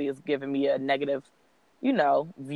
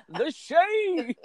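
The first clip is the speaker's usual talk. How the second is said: high-pitched, through laughter; words riding on a laugh; laughing exclamation. Real, not acted. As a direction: squeal it while cracking up. Laughing at who is listed.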